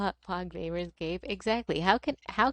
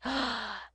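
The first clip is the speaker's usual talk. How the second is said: mock gasp; 6 semitones higher, 4 dB quieter than usual. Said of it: "the main heroine is like".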